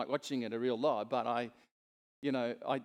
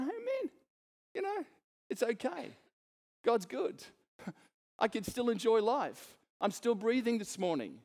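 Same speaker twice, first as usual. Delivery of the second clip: high, bright, upbeat; cheerful encouragement; 7 semitones higher than usual. Shown as a demonstration. Preacher modelling positive talk to the church, high and upbeat. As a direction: sound buoyant and glad.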